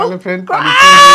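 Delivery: loud gleeful announcement; excited shout. Acted. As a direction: shout it out with glee. Announcing punishment to friend.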